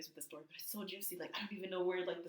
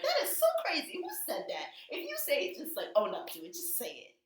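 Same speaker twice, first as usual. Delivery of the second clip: mock outraged Portia; 9 semitones higher than usual. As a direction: squeal the shock like a guilty gossip. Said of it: "being like".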